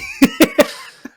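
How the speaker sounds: a high-pitched laugh at his own movie grudge; high squeaky laugh. Real, not acted. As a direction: burst into a squeaky giggle.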